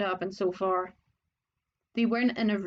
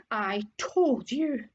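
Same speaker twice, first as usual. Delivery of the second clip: gasped out; slower than usual. Acted. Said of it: "Hermione gasped".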